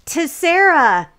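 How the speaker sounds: slow, loud, high mock toast; lovestruck toast. Smitten friend toasts new girlfriend, mock lovestruck toast. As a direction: sing out the name, stretched and proud.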